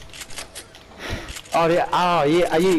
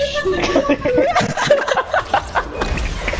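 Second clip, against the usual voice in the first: high laugh. Real, not acted. Gamer cracking up at his own joke, high laughter. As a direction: burst of high cackling laughter.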